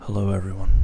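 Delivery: close-miked, filter off; right in your ear. Real, not acted. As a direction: lips right on the mic. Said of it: "Right in your ears".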